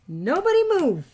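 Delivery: jokey loud order to hold still; tense command. Real, not acted. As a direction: call out to freeze everyone. Tense moment, commanding everyone.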